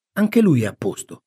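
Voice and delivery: usual speaking voice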